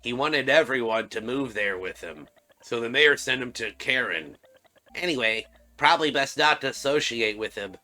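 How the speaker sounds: gossiping game character. Acted. Townsperson warns about the fantasist, gossiping.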